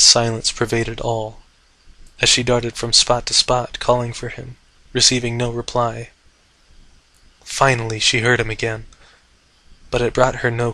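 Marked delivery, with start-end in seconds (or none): none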